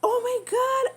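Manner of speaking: put-on high, loud squealing girl; near-tearful excited squeal. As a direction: shriek it like the best news ever. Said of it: "I took over that conversation".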